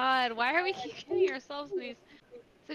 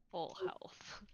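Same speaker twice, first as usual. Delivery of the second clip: near whisper; 12 dB quieter than usual. Real, not acted. Player admitting she's not near full health, whispered. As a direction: voice dropping to a whisper.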